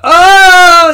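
high, loud pained cry; contraction groan. Retold or imitated. Pam in labor mid-contraction, high pained cry.

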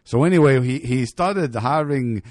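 loud storytelling. Real, not acted. Telling story loudly.

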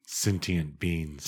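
slow, soft "Sentient" as SEN-tee-ent. Shown as a demonstration. Testing it aloud, SEN-tee-ent for "Sentient". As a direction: try "Sentient" slowly with the T sounded. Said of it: "Is it sentient or sentient?".

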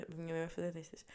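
usual speaking voice

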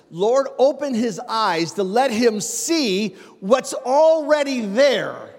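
slow, building sermon cry; preaching. Acted. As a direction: pray it out like a rising preacher. Preaching climax, prayer voiced.

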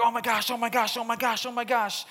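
Caitlin panicking. Retold or imitated. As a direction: imitate a stressed-out friend repeating herself frantically. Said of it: "You're like Caitlin".

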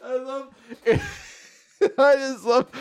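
slow, high, broken up by giggles; laughing. Real, not acted. Host relishing the scene, laughing. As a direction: struggle to speak through real laughter. Still cracking up.

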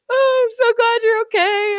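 high, slow, teary mom voice; tearful mom. Retold or imitated. Mother hugging daughter after crash, tearful relief. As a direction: gush with relief like a weepy mom. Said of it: "she's like".

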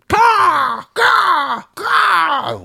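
very high, loud crow caws; shrill screeching bird woman. Pretend. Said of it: "What a stupid sound".

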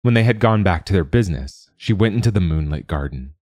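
usual speaking voice

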